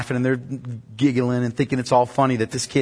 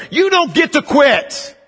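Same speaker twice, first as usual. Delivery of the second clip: high, slow, forceful command; militant preaching; 12 semitones higher, 4 dB louder, much slower than usual. Real, not acted. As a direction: hammer it home to the congregation. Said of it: "That's a little more militant".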